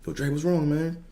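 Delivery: high, light voice for a friend; concerned teammate. Retold or imitated. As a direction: ask what's wrong in a high voice. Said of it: "they were like".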